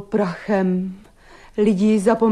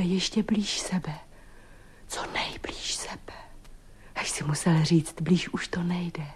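soft, low near-whisper; intimate hush; 4 semitones lower, 10 dB quieter than usual. Acted. Woman recalling being close to her partner, hushed and intimate. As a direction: let it sink into a breath-quiet murmur.

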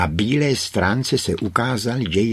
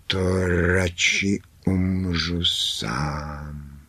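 slow, drawn-out beast voice; sorrowful beast; much slower than usual. A narrator's reading. Beast facing Kráska's leaving, slow and sorrowful. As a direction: draw the words out with resignation.

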